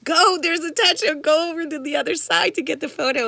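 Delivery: loud, high call of touchdown; mock yell. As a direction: call it out like a cheering crowd. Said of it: "I'd hear them screaming".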